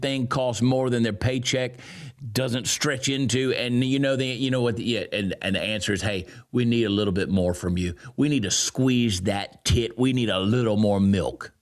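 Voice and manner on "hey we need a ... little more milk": lower, wheedling mock of officials; mocking government voice. City officials asking workers for more, lower and mocking. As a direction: play the greedy state asking nicely.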